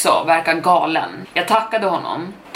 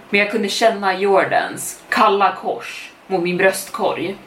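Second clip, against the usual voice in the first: plain reading voice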